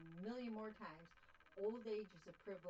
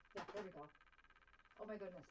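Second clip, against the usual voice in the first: ordinary delivery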